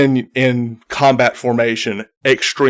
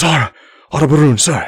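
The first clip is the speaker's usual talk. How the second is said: urgent messenger; 3 semitones higher, faster than usual. Acted. Messenger Goliath rushing in.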